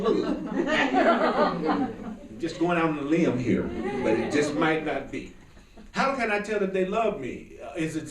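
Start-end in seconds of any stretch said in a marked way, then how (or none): 0.0-1.9 slow, higher, giggling understatement; drawn-out amused punchline; laughing. Real, not acted. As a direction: tease it out while laughing.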